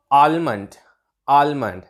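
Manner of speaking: आलमंड with the L sounded; mispronounced almond. Shown as a demonstration. Teacher showing learners the common mistake, mispronounced with L. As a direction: say आलमंड with an audible L after the first vowel. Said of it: "गलत pronounce कर रहे हैं".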